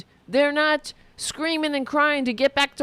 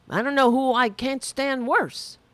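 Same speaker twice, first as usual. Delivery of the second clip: ordinary talk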